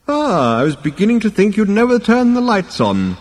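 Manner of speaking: put-on high, loud old figure's voice; crotchety elder calling out, pitched high; querulous old ancestor. A narrator's reading. Old ancestor's first acted line.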